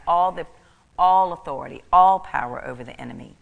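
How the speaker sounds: loud, forceful proclamation; full-force preaching; emphatic preaching. Real, not acted. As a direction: drive the point home hard.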